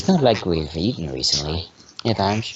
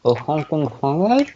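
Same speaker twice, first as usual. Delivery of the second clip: high sing-song tease; 8 semitones higher than usual. Acted. Mocking sing-song word play.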